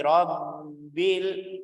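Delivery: slow Rabbil, bb held long; held double b. Shown as a demonstration. Teacher slows Rabbil to show the doubled b. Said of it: "Rabb ini suaranya".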